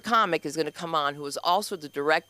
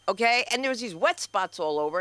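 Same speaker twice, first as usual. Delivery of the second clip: ordinary talk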